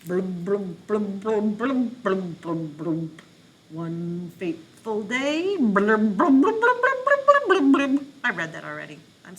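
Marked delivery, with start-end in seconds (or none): 0.1-3.2 bubbly blum blum sounds as if under water; underwater blubbing. Shown as a demonstration. Teacher demos reading underwater with bubbly blums. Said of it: "pretend to be underwater while you read". 5.6-8.1 high, loud bubbly blums; loud underwater blubbing. Shown as a demonstration. Teacher demos underwater reading, loud bubbly blums. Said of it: "pretend to be underwater".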